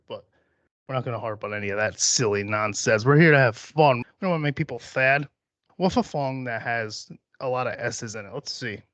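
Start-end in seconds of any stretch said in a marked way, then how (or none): 5.8-8.3 slushy cartoon-duck lisp on the S's; Daffy Duck lisp. Host hunting for an S-heavy song, Daffy Duck lisp. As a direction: lisp every S into an F-like sound. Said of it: "Let's Daffy Duck this shit".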